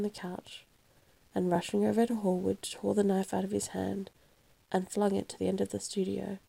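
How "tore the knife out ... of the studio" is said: usual speaking voice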